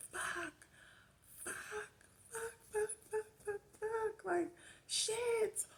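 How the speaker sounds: high, strained cries of pain; yelling in pain. Retold or imitated. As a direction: cry out the swearing in labor agony. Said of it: "I was in there yelling".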